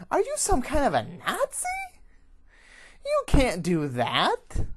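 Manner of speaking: high, loud incredulous protest; growled outrage. A narrator's reading. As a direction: yell back at your accuser, outraged. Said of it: "The fox growls".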